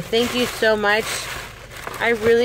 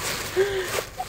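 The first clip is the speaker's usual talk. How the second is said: sharp high breathy gasp of surprise; delighted gasp; 9 semitones higher, 7 dB quieter than usual. Real, not acted. Opening the gift bag, a surprised gasp. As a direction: catch your breath at the surprise.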